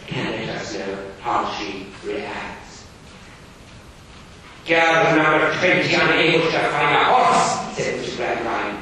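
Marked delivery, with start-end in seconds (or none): 4.7-7.8 loud, high, stern schoolmaster. Acted. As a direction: bark it like a strict Victorian teacher. Gradgrind voiced in reading.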